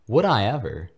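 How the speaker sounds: put-on loud, eager delighted boy; delighted cry. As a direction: burst out with happy eagerness. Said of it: "Harry cried delightedly".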